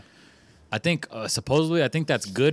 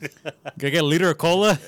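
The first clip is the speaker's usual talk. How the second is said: Super Troopers quote; 3 semitones higher than usual. Acted. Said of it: "Super Troopers".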